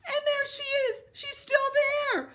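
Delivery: very high, excited little bear; high, loud, hurried tiny bear; Baby Bear voice. Acted. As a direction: squeal it as an alarmed baby bear. Baby Bear's turn in the story.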